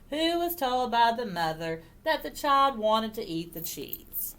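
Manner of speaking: plain reading voice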